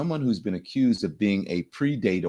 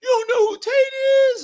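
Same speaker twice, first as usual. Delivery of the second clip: much higher, louder mock disbelief; hammed-up shocked bro voice, much higher; incredulous bro; 4 dB louder, slower than usual. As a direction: shout it like a stunned podcast bro. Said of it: "And they're like".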